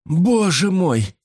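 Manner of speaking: high, drawn-out wail of dismay; plaintive, anxious companion voice. A narrator's reading. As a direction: moan it high and slowly. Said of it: "раздался вдруг жалобный голос".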